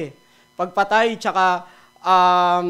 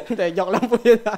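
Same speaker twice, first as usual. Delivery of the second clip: laughing apology; 4 dB louder than usual. Real, not acted.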